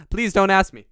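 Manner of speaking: loud, high playful plea; loud, hammed-up begging; mock plea. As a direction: belt out a silly, high-pitched plea. Playful pleading bit, loud.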